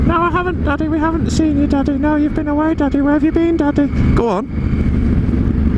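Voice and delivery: put-on very high, squeaky children's voice; hammed-up children's squeak; squeaky kids' voice. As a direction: pipe up like little kids missing their dad. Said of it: "Go on, do it in a squeaky voice".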